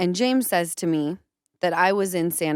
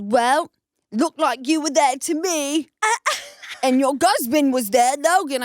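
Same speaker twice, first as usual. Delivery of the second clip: higher mocking impression of James; 7 semitones higher, 3 dB louder than usual. Retelling James accusing her, mocking his voice. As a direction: sneer it back as James, lightly mocking. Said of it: "He goes".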